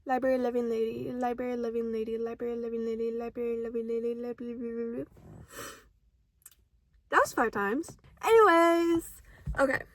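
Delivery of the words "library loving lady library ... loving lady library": fast, rattling repetition; tongue twister. Shown as a demonstration. Taking her own five-times-fast challenge, rapid repetition. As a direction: rush it five times over. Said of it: "Now say that five times fast".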